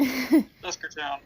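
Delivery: slow dramatic naming; drawn-out name. Acted. Player savouring the town name slowly. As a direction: stretch the name out ominously.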